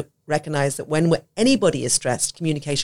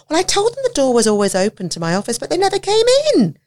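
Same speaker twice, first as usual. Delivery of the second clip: much higher, louder sing-song voice; Senko's voice; 11 semitones higher, 4 dB louder than usual. Retold or imitated. Senko explaining why the child never came, high sing-song. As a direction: put on the Senko's piercing sweetness. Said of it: "It's 'cause of her voice".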